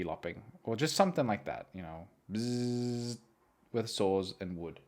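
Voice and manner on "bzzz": drawn-out saw buzz; long vocalized bzzz; buzzing saw imitation. Imitating a saw cutting wood.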